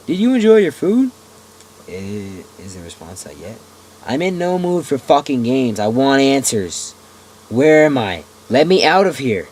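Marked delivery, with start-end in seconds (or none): 0.1-1.1 cheerful captor voice. Acted. 4.1-9.5 angry demands. Acted.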